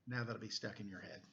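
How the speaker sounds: ordinary delivery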